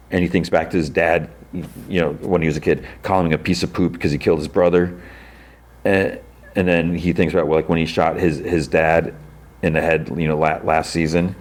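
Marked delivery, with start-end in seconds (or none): none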